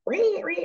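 high, soft imitation screech; mock pterodactyl screech. Coach mocking her own voice, high pterodactyl screech. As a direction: squeal like a pterodactyl, held back in volume. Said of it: "I feel like a screaming pterodactyl".

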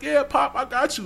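high, eager yes; high nervous agreement. Retold or imitated. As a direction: nod along in a high voice. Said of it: "I'm shaking it".

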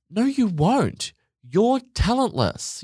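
high, slow, drawn-out put-down; blunt put-down. Acted. Telling a hopeful singer they won't be signed, bluntly dismissive.

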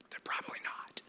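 hushed, voiceless whisper; whispered aside. Real, not acted. Preacher to congregation about their talented kids, whispered aside. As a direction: drop to a confidential whisper.

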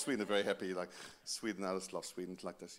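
very low, quiet monotone; deadpan happiness. Acted. Preacher teasing Swedes as very happy, in deadpan monotone. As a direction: claim delight with no life in the voice.

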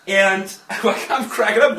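normal talking voice

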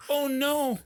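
high mock dismay; a touch of hammed-up concern; imitating friend. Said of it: "he said".

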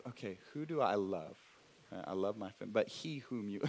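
soft, puzzled, thinking it through; as if wondering who is meant; mock puzzled musing. Pastor imagines hearing the news, puzzled. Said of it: "it's like".

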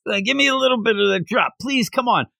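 high, fast, wheedling addict voice; druggie begging. Retold or imitated. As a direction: plead for a little taste like a junkie. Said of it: "comes off like a, a druggie".

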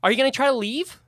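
high, eager snake creature pestering. Acted. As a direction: pitch it up as a pushy little snake.

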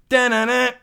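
high loud sung jingle; ESPN jingle. Retold or imitated. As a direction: taunt with the ESPN sports theme. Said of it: "He goes".

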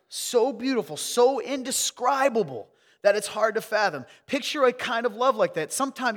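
loud, high, impassioned preaching; forceful preaching. Acted. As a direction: preach it with mounting fervor.

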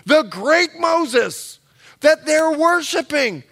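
ordinary talk, nothing marked

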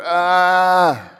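mock agonized wail; imitated cry of pain. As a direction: moan like the man in the next room. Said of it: "Just over and over".

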